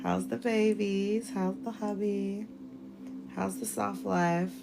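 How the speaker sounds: slow, drawn-out friendly hello; slow singsong catch-up with a friend; affectionate greeting. Real, not acted. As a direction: stretch each greeting out fondly. Greeting a friend joining the livestream.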